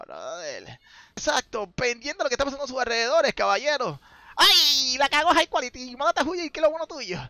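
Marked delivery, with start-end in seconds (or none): 4.3-7.2 real excited yelling, pitched way up; frantic urging. Real, not acted. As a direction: shout at the player to run. Caster urges player to flee.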